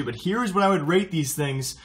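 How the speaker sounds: normal talking voice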